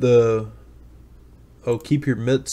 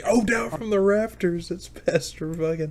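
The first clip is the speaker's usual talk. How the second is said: higher, hushed parody of a ring announcer; mock wrestling announcer; 7 semitones higher, 6 dB quieter than usual. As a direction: announce the pastor like a wrestler, half-joking. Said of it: "stealing their stick from wrestling".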